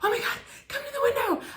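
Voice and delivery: high, breathless mom calling out; excited mom. Retold or imitated. Mom calling Keisha to the window, high and excited. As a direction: squeal it like a thrilled mother. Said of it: "my mom said".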